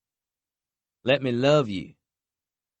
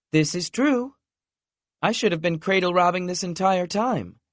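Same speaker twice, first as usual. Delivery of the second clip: higher voice, teasing; voiced playful woman; 10 semitones higher, 3 dB louder than usual. A narrator's reading. Lexi teasing Knox about cradle robbing, voiced higher. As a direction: joke like a teasing girlfriend.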